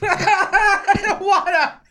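whiny laughing outburst. Real, not acted. Drunk whining outburst mid-podcast.

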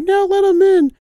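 high pleading mom voice; mock mom. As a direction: mom begging dad in high voice. Mom's voice in story.

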